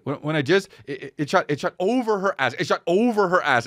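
lightly imitating a stammering friend; friend's stammer. Imitating how the friend told it, halting stammer. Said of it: "this is how he talked".

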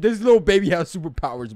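loud amazed outburst; loud disbelief. Real, not acted. Amazed at the baby slipping away, loud disbelief. Baby escapes his grip.

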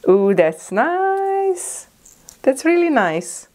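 high, slow drawn-out ooh of delight; delighted coo. Real, not acted. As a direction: coo over the lipstick shade. Unboxing, delighted over shade.

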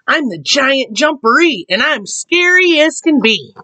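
high-pitched booming boast from the burrow; loud giant voice. A narrator's reading. Hidden Giant Jumperee warning Rabbit, loud voice. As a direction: put on a big scary voice, pitched high. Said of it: "he heard a loud voice".

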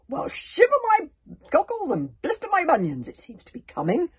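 high, loud booming exclamation; blustery chief exclaiming. Acted. Stoic, amazed at the knocking cauldron, exclaims loudly. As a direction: bellow it like a startled chief. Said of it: "exclaimed Stoic".

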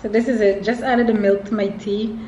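ordinary delivery

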